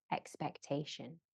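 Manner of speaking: ordinary talk, nothing marked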